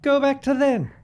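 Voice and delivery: high, fast strained villain voice; bad movie reenactment. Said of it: "that was a bad reenactment of the movie".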